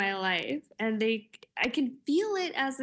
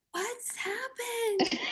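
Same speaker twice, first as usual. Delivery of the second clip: put-on high, slow, laughing little-kid voice; little kid asking; 12 semitones higher, 3 dB quieter, much slower than usual. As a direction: squeak it out like a puzzled small child, through a laugh. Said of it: "a little kid behind me who's like".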